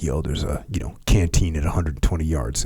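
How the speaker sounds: hushed low side tip; whispered tip. Retold or imitated. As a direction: slip it quietly to the next guy. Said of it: "they kinda tell each other".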